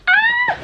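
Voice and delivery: very high, loud squeal; excited squeal. Real, not acted. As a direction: let out a piercing little shriek of delight. Squeals over her new t-shirts.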